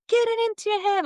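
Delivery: high, loud commanding voice of the plant; plant spirit yelling. Retold or imitated. The plant spirit yelling at her during the ceremony, high and loud. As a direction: bark it like an insistent spirit. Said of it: "she was yelling at me".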